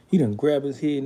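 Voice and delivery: ordinary talk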